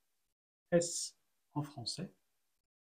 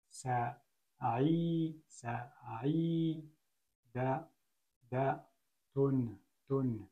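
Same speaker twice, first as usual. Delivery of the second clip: syllable by syllable; much slower than usual. Shown as a demonstration. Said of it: "Donc si je lis".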